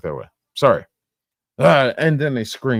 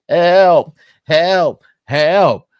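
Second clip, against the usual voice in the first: loud, higher mock cries of help; 8 semitones higher, 10 dB louder, much slower than usual. Streamer imitates the driver crying out, loud hammed-up help. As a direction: yell it like a hammed-up victim. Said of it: "cries of".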